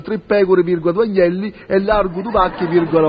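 normal talking voice

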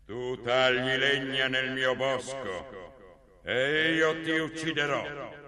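slow, booming troll threat. Acted. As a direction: drag out each word like a giant monster. (voce di troll lenta e minacciosa)

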